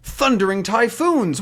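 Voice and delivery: a sailor swearing at the rival hunters; Haddock's oath. Acted. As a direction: bellow it like an old sea captain. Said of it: "he says".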